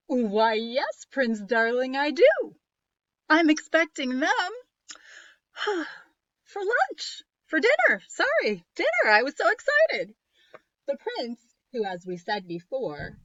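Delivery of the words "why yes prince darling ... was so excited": loud, high gleeful witch; a witch who can't wait for dinner; excited witch. Acted.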